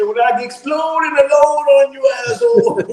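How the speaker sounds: mock Jamaican accent, breaking into laughter. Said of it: "He go into his Jamaican accent".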